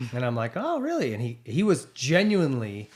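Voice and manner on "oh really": high-pitched surprised reply. Retold or imitated. Podcaster retelling his reply to the team boss, surprised. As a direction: react with a rising, piqued interest.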